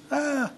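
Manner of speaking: short high mock demon shriek; a quick, high spirit's shriek, half-hearted. Shown as a demonstration. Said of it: "The spirit shrieked".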